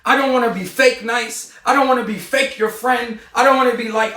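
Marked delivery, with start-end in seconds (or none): none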